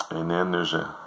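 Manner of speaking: ordinary talk, nothing marked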